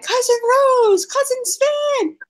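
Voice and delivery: high, drawn-out cousin greeting; mock delighted greeting. Fan reenacting cousins' first meeting, mock delighted.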